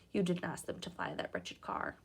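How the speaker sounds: low, soft and kind; mother reassuring Harry, low and soft; gentle reassurance. Acted. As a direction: soothe him quietly.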